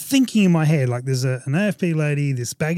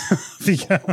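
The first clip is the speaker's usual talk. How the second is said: higher, said through laughter; laughing repeat; 5 semitones higher than usual. Real, not acted. Storyteller repeats officer's send-off, laughing. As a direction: echo the dismissal with a laugh.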